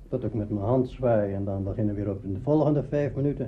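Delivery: ordinary talk, nothing marked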